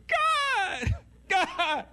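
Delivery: high, loud, hammed-up wail; dramatic cry to God. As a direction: cry out theatrically as if hurting. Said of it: "'cause you gotta make it dramatic".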